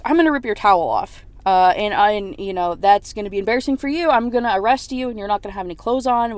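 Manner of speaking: retold anger, punched and loud; angry threat. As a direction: press the threat forcefully. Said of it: "he's getting more and more angry".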